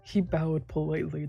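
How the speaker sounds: usual speaking voice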